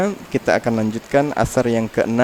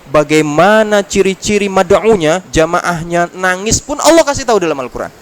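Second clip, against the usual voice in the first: loud, higher preaching at full force; fiery preaching; 4 semitones higher, 5 dB louder than usual. Acted. Preacher rousing the congregation in a loud, raised voice.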